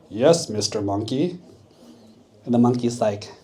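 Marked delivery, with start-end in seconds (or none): 0.1-1.4 fake rock voice. Crocodile pretends to be a talking rock, put-on voice. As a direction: play a crocodile faking the voice of a rock.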